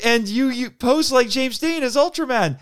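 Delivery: high-pitched excited retelling; freaking out. Retold or imitated. Fan putting the connection to the actor, freaking out. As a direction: gush it out high, stumbling in. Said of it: "I was just freaking out".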